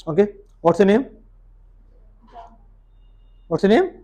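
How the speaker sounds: ordinary talk, nothing marked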